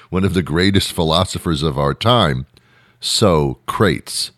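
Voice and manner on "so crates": Socrates as a deadpan "SO-crates"; Socrates mispronounced. Shown as a demonstration. Greatest philosopher of our time introduced, Socrates mispronounced as "so-crates". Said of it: "He mispronounced it".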